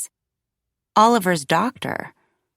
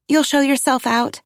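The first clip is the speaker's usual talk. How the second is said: higher-pitched, light call; 7 semitones higher than usual. A narrator's reading. Mother rushing toward the door, calling to Paul. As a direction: toss it back over to him. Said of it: "I called to Paul".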